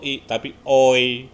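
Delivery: "oi" slow, gliding from o to i; diphthong oi. Shown as a demonstration. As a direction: glide "oi" slowly. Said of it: "O sama I nggak O".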